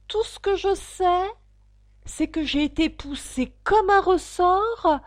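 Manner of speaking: petite voix flûtée. Acted. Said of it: "une petite voix faible et flûtée".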